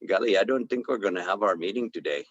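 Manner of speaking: imitating a light Indian accent; a touch of an Indian accent. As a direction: mimic the doctor's Indian English, mildly. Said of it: "he's a little Indian".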